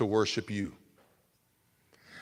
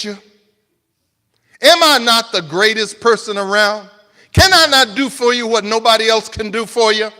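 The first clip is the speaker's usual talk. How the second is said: loud, higher pleading outrage; hurt pride shouted as questions; wounded angry king; 10 semitones higher, 12 dB louder than usual. Retold or imitated. King Nebuchadnezzar berating the three young men, loud anguished anger. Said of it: "He might as well have been saying".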